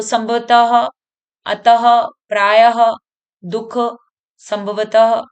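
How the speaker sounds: emphatic ha closing "संभवतः", "अतः", "प्रायः"; visarga h sound. Shown as a demonstration. Teacher repeating examples for pupils, stressed h ending "प्रायः". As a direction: list them one by one, breathing out ha after "अतः". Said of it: "तो ये हमें ह का".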